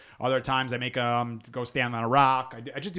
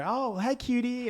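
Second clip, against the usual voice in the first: mock cooing stranger; 11 semitones higher, slower than usual.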